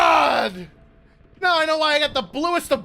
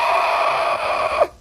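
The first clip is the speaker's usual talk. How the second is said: long voiceless scream; frustrated scream; 4 dB quieter than usual. Real, not acted. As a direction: let out a drawn-out airy scream. Frustrated outburst in game.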